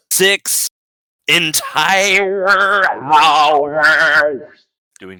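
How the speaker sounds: slow, stretched, each word hammered; stressed in exasperation. Real, not acted. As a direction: drag out Six entire hours with rage. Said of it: "quaked in rage".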